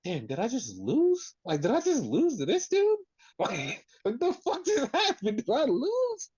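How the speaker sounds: high, squeaky, laughing voice of a stunned loser; mock stunned Cody. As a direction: squeak it out in disbelief, cracking up. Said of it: "you can see Cody's face like".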